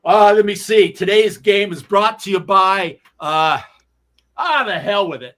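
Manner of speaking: imitating a radio announcer reading a sponsor line; mock broadcast ad read. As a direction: ham up a radio sponsor read, then quit.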